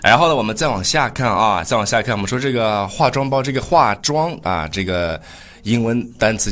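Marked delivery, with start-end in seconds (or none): none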